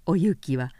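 plain reading voice

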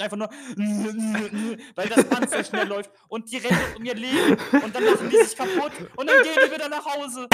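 laughing while telling it; lachend erzählt. Real, not acted. Lacht beim Erzählen über Gym-Leute. (lachend, höher und lauter)

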